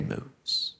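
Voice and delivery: breathy whisper trailing off; whispered softly as the breath fades. Acted. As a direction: let the word fade into a whisper like the breath itself. Feather barely moves image.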